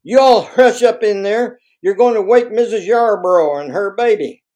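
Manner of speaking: loud, high scolding yell; yelling mother. A narrator's reading. Mother hushing her children through the wall, yelling back. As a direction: holler back at noisy children. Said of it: "she yelled back at them".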